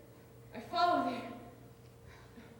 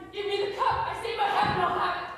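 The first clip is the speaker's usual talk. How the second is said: forceful demand; 6 semitones higher, 7 dB louder than usual. Acted. Hamlet wrests the cup away.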